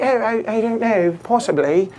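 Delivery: evasive British umpire; British accent. Retold or imitated. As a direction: dodge the question in a put-on British accent. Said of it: "just full British accent the whole time".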